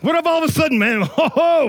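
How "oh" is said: high, drawn-out "oh"; mock shocked exclamation. Churchgoer suddenly smelling someone's sin, mock shock. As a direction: exclaim as if hit by a stink. Acts reacting to a sudden stink.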